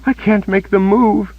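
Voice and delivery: paralysed Kaelin telling Richard her legs won't move; panicked. A narrator's reading. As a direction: plead in helpless fear. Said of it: "Her voice quivered with panic".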